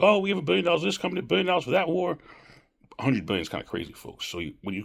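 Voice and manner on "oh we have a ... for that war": higher, fast mocking voice; mocking people. Mocking how people brush off spending, higher and fast. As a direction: rattle it off quickly and higher, sneering. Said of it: "People are like".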